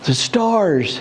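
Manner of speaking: put-on high, excited little boy gasping at the stars; a grandson amazed, nearly out of breath; awed small child; breathless child's awe. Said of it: "like, almost out of breath".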